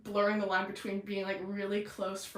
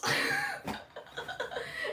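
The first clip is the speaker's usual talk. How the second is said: high laugh. Real, not acted. Laughing at her own scene.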